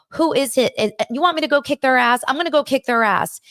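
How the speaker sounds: high, fast, fired-up protective mom; mama bear. Retold or imitated. Mother to her hurt son about his attacker, acted fierce outrage. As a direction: act out a mother ready to fight for her son. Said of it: "Mama Bear comes out".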